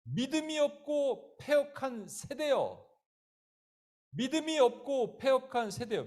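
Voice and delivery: high, drawn-out proclamation; ringing, solemn denunciation; stern rebuke. Acted. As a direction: cry out in reproach to a whole generation. Jesus' harsh rebuke, acted.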